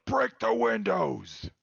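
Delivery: high, loud drawn-out yell; bellowed battle order, words stretched; yelled command. Acted. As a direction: shout the order out long and high. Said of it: "will yell out".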